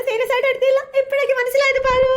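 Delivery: loud, high wailing cry; frightened friend wailing she now believes, loud; mock wail.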